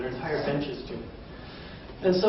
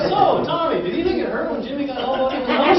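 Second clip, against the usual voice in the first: high, bright mock reporter asking kids; mock eager reporter; 9 semitones higher, 4 dB louder than usual. Sportswriter asking a kid about his nose, higher mock voice. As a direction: play the earnest reporter with a dumb question.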